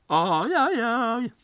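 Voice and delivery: very high, loud yodelled yell; Tarzan howl. A narrator's reading. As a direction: howl like Tarzan, holding the last note. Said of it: "ylar Peter".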